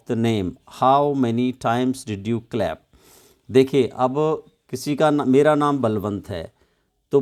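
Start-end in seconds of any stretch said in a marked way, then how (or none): none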